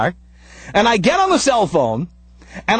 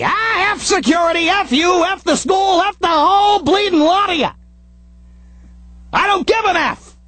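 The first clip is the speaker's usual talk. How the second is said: defiant cursing; 6 semitones higher than usual. Retold or imitated.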